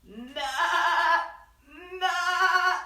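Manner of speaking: loud, sung imitated nah; goat bleat. Goat answering duck with a sung bleat. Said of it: "said Goat".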